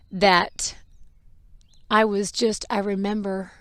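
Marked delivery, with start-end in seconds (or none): none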